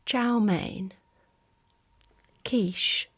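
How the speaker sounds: ordinary talk, nothing marked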